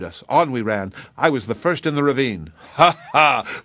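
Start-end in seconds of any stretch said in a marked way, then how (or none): 2.7-3.6 loud, higher "ha, ha"; exultant spoken laugh. A narrator's reading. Veteran boasting of his charge, loud exultant laugh. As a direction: crow over the memory. Old soldier recalls charging the ravine.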